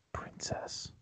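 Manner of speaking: breathy whispered word. Real, not acted. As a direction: drop to a whisper. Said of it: "I don't know why I whispered that".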